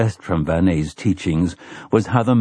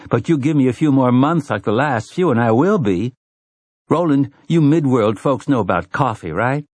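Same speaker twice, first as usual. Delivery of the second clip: higher-pitched, chatty woman's voice; sassy Susanna; 5 semitones higher than usual. Acted. Woman bantering with companions about coffee, high and sassy. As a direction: play her high and lively, bantering.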